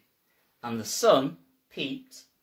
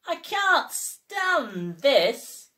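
weary complaint; 8 semitones higher, much slower than usual. Acted. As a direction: drag every word out, fed up. Mister Bear complains, fed up.

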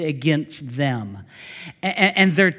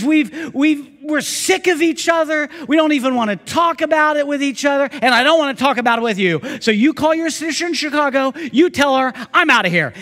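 loud, higher, exasperated dad; fed-up father; 9 semitones higher, 8 dB louder than usual. Retold or imitated. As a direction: rant it like he's done. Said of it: "He says, he says".

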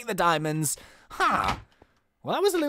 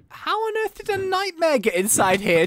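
startled; 10 semitones higher than usual. Real, not acted. Nightmare mob appears.

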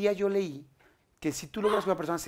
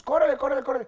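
hurry up; 10 semitones higher, 5 dB louder, faster than usual. Retold or imitated. Producers rushing him.

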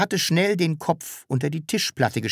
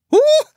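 sudden piercing high shriek; a short yelp of horror; horrified shriek; 10 dB louder than usual. A narrator's reading. Landlady shrieks grabbing the strange child, horrified. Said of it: "schrie sie entsetzt".

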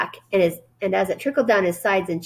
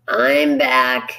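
low, husky rat voice; 7 semitones lower, 4 dB louder than usual. A narrator's reading. Templeton the rat drags back in, low and husky. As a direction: rasp it out, tired after a long night. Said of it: "he said in a husky voice".

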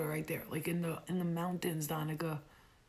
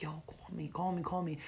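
hushed flirt; 6 dB quieter than usual. Retold or imitated. Soldier flirting quietly.

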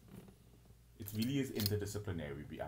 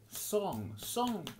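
squeaky mocking repeat of "Song", much higher and louder; 14 semitones higher, 6 dB louder than usual. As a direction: parrot "Song" in a shrill-pitched mock. Repeats word in mock voice.

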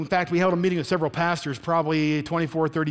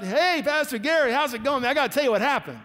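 higher, bubbling-over retold greeting; excited greeting; 6 semitones higher than usual. Pastor greets Gary after his house is saved, excited. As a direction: burst in like a man with great news. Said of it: "he was bouncing off the walls".

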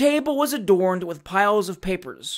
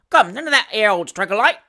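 loud, cheeky Cockney servant; 4 semitones higher, 6 dB louder than usual. Acted. Sam Weller voiced.

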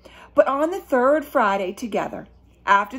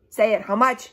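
plain reading voice

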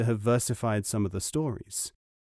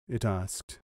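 ordinary delivery, nothing marked

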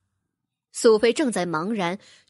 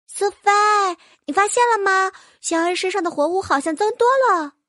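higher, lightly voiced small sprite; little sprite voice; 7 semitones higher, 4 dB louder than usual. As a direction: chirp it like a tiny fairy. Little sprite speaks to Sophie. (轻声扮演的小妖精)

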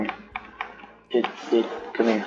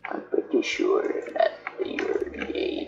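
corpse voice; 12 semitones lower than usual. Acted. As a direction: drone it out like a dead man. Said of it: "Why are you talking in a corpse voice?".

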